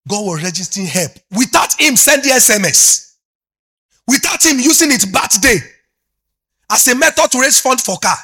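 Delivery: high-pitched, driving testimony; excited pulpit proclamation; impassioned preaching. Real, not acted. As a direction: preach it at full force, pitch lifted right up. Preaches testimony at full force.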